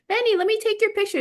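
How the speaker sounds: high, fast, sugary coaxing; sweet girl voice. Retold or imitated. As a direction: sweet-talk the dog, high and quick. Girl coaxing the dog for a photo.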